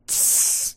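short sharp "tss" of a burn; voiceless hiss for a zinger landing; imitated sizzle. Preacher imagining Jesus relishing a put-down, imitated sizzle.

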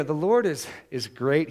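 ordinary delivery

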